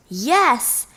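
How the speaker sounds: plain reading voice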